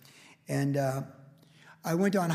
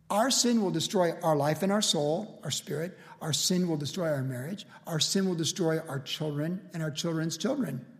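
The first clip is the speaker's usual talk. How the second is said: ordinary talk, nothing marked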